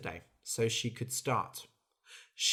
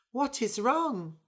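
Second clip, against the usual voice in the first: worried mouse voice; 10 semitones higher than usual. Acted. Mouse worried about bird.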